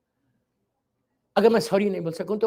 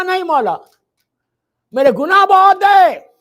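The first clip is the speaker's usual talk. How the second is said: anguished plea; 10 semitones higher, 14 dB louder than usual. Acted. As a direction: cry out that the sins are too many. Sinner's anguished plea to Ali.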